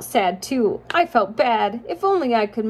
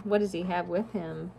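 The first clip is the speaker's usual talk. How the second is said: ordinary delivery, nothing marked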